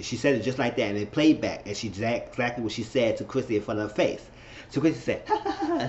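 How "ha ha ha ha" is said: high mocking ha ha ha; a scornful fake laugh, pitched way up; sarcastic staccato laughter. Chrissy mocks with a laugh.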